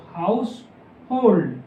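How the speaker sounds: slow हाउसहोल्ड, clear h before होल्ड; slow demo pronunciation. Shown as a demonstration. Shorthand teacher to students, slow clear demo of the word. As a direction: draw out हाउसहोल्ड for the class. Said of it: "L से पहले हमारा एच की sound आ रही है".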